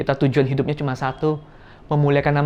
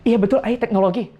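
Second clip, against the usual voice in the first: hasty agreement; 8 semitones higher, 4 dB louder than usual. Retold or imitated. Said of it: "Koko jawab apa?".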